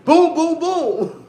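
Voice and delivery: loud, high "boom, boom, boom" like gunfire; gunshot sounds. Retold or imitated. Mimicking gunshots the son hears, loud and laughing. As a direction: blast out the gunshot noises, laughing. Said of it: "wake up to gunshots".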